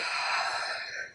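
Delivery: long, soft unvoiced sigh; nervous sigh. Real, not acted. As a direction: breathe out the nerves. Nervous before spraying glue.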